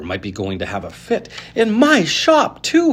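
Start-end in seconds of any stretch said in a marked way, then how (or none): 1.5-2.9 much higher, slow, loud yell; bursting with pride. A narrator's reading. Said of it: "he yelled".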